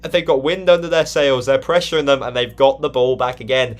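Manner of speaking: lively football play-by-play; live commentary. Acted. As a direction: build the story of the goal.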